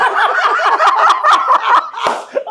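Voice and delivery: squealing laugh; high laugh. Real, not acted. Laughter at joke.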